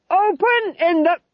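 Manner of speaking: high, loud, slow bellow; official shout. A narrator's reading. Sergeant shouting at the cottage door for the king, bellowing. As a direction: bellow a formal command, cut off midway.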